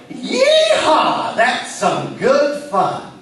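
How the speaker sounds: hammed-up cowboy whoop; excited cowboy. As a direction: holler like an Old West cowboy. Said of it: "jump up and yell".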